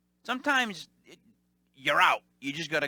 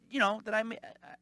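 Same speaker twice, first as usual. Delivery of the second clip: ordinary talk, nothing marked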